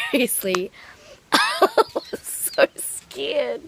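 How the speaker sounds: laughing through a stretched-out confession; laughing now. Real, not acted. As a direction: break into real laughter mid-sentence. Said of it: "I can laugh now".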